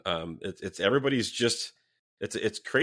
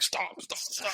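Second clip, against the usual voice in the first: hushed, whispered plea; imitated urgent whisper; 10 semitones higher, 7 dB quieter, much slower than usual. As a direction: whisper like a friend discreetly holding someone back. Friend tugging shirt, urging him discreetly.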